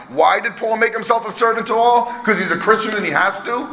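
ordinary talk, nothing marked

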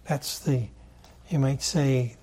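usual speaking voice

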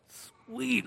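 high, hushed mock glee; acted giddy aside. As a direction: squeal it softly, secretly thrilled. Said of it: "kind of all giddy".

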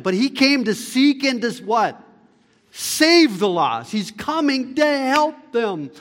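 impassioned sermon climax at full voice; fervent preaching. Acted. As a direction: lift them up with burning conviction. Preaching climax, rising fervour.

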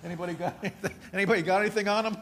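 nervous questions that build into laughter; a city guy half-joking, asking for a gun; laughing mock panic. New Jersey visitor hears coyotes, asks for a gun, laughing. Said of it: "you're like".

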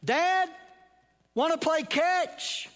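high, loud call across the field, slowed. Acted. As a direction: call out to his father, drawn out. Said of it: "he calls out".

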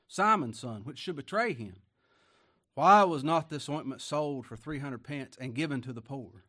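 loud, emphatic Bible reading; scripture reading. Acted. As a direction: read the verse out like a preacher. Reads scripture aloud forcefully.

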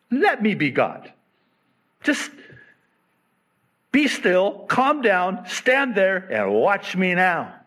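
God insisting, watch me now; God's voice, firm. Acted. Voicing God, paraphrase.

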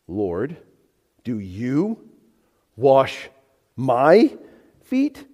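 slow, word by word, rising sharply on FEET; incredulous question with pauses between words, lifting on "feet"; emphatic question. Acted. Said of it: "Very emphatic the way he asks this question".

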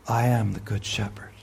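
hushed whisper of the verse. Real, not acted. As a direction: breathe the words like a secret. Whispered scripture quote.

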